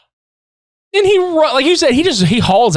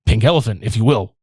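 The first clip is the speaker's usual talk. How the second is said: much lower, halting mock politeness; awkward insistence; 10 semitones lower than usual. The hero insists to the carny, low and stumbling. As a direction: stammer it out stiffly in a low voice. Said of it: "He's just like".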